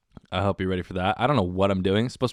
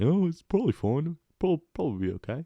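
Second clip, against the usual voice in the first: a British cop shrugging it off, higher; breezy put-on British accent; British voice saying it'll be fine; mock British accent; 8 semitones higher than usual. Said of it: "I went British for that".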